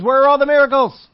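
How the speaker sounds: loud, high demanding shout; exasperated outcry. Retold or imitated. Gideon demanding where the miracles are, loud and high. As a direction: demand it loudly, fed up. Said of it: "He says".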